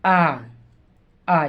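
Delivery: आ said with the mouth opened wide; long open aa. Shown as a demonstration. Teacher to students, demonstrating a wide open आ. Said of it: "आ बोलेंगे थोड़ा सा बड़ा खुल रहा है".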